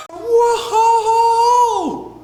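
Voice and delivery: long, high wailing whoa; loud falling cry of alarm; elephant's startled holler. Acted. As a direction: howl as you are yanked away. Elephant being dragged to water.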